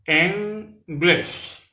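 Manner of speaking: English said /enɡleʃ/, first syllable EN stressed, high and clear; two clear syllables EN-glish; model pronunciation. Shown as a demonstration. Teacher modelling English for French learners. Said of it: "vous devez prononcer les bien".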